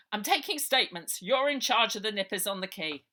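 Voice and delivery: loud, bossy order; officious sergeant. Acted. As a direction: lay down the law.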